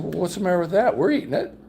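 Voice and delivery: high-pitched retort, mock exasperation; protesting comeback. Host answering his angry dinner guest, protesting. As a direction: argue back, pitch jumping up.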